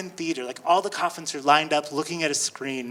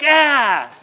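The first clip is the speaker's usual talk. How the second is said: mock scare shout; 6 semitones higher, 8 dB louder than usual. Actress jumps out scaring.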